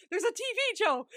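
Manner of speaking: high, loud excited call; Nicky. Retold or imitated. Calling to Joe from inside the plane, Nicky. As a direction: call out delightedly from another room, hammed up. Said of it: "You just hear his voice go".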